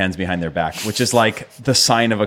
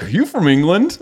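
higher-pitched, naive mock question; imitated American questioner; 6 semitones higher than usual. Imitating Americans guessing at an Irish accent, mock questioner. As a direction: play a clueless American asking. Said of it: "most Americans would be like".

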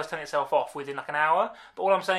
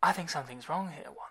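a hint of hushed Vision; quiet android concern; Vision impression; 8 dB quieter than usual. Third audition take for co-host, softly voiced Vision. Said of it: "Third time".

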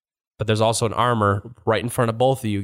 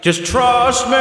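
hyped performer voice; 13 semitones higher, 5 dB louder, slower than usual. Acted.

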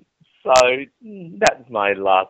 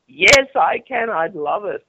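much higher, louder, slow gushing; mock swooning crowd; 12 semitones higher, 9 dB louder, slower than usual. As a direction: stretch it out like an eager swooning fan. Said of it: "and they all go".

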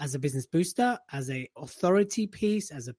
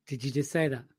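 mock gasp; 4 semitones lower, 5 dB quieter than usual. Mock offended reaction.

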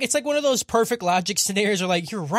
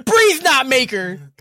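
drawn out; 6 semitones higher, 5 dB louder, much slower than usual. Real, not acted. Savoring the silly name slowly.

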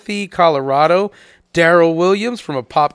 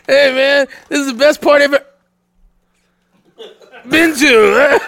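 concussed partygoer; 7 semitones higher, 4 dB louder than usual. Retold or imitated. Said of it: "He's like".

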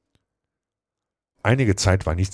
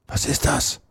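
high, breathy stage whisper; 10 semitones higher than usual. A narrator's reading. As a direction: whisper the question urgently. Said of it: "flüsterte Tom".

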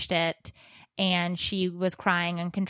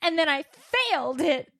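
daughter's dramatic complaint; 7 semitones higher than usual. Retold or imitated. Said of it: "she's like".

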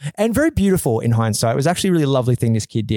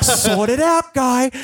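mock angry; 11 semitones higher, slower than usual. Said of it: "giving him just death daggers".

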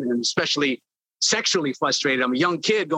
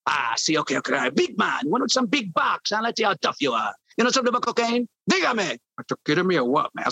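higher mock Tony Montana Cuban accent; Scarface accent; 3 semitones higher than usual. Intern doing the Scarface skit for Jay, mock Cuban accent. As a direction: play the Scarface gangster, cocky. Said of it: "I was watching Scarface".

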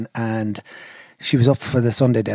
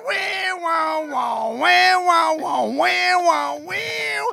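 high vocal wah-wah; guitar wah; much slower than usual. Retold or imitated. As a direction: mimic a wah pedal. Imitating guitar wails.

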